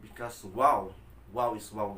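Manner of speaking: ordinary delivery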